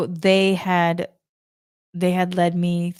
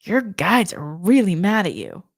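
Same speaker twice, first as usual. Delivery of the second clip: psychic's warning. Retold or imitated. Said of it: "the psychic said to me was".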